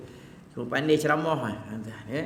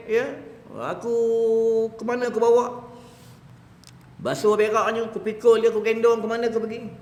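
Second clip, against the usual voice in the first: high, loud fervent preaching; preaching at full force; 9 semitones higher, 6 dB louder than usual. Acted. Preacher voicing the youth who carried his father, in loud fervent preaching. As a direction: pour it out to the congregation.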